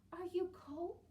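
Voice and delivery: high, loud, concerned; caring girl. Acted. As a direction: ask the shivering stranger kindly. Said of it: "Sadie asks old woman".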